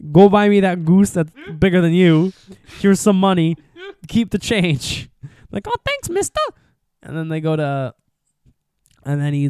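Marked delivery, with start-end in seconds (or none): 0.1-5.1 put-on loud, higher-pitched call down to a kid; big retold holler, slowing on the last words. Voicing Scrooge calling to boy. 5.7-6.4 very high, squeaky little boy impression; mock child, pitched way up; kid's voice. Voicing the boy replying.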